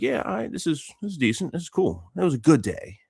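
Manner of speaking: high-pitched acted self-talk; mild contentment. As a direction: play someone talking himself into approval. Voicing his contented self-talk.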